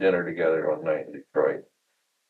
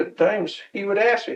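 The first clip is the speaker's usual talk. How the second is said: ordinary talk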